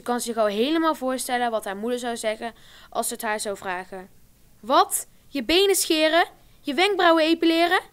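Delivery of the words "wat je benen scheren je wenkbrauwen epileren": high, louder indignant disbelief; shocked outrage. Retold or imitated. Imagined mother reacting to shaving and plucking, shocked. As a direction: sound scandalised.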